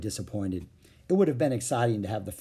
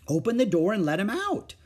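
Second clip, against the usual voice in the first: mother's instruction; 9 semitones higher, 4 dB louder than usual. A narrator's reading. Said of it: "said Mother".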